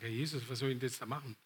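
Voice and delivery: low, soft, quick aside to Jesus; inward prayer. Retold or imitated. Preacher retells his silent plea to Jesus, low inward prayer. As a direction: pray it under your breath, hurried. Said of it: "ich war innerlich am Beten".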